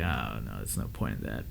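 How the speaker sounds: low, soft, resigned murmur under the breath; defeated murmur. Retold or imitated. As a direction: talk yourself out of calling, barely voiced. Inner thought at 4 AM.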